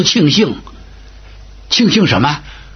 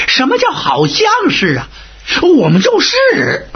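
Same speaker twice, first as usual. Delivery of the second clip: indignant wail; 13 semitones higher than usual. Acted. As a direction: wail in offended disbelief. Officials offended at not being recognized.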